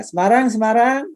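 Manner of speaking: high, rising roll call. Real, not acted. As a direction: call out for anyone from that city. Calling out cities to audience. (memanggil dengan nada tinggi)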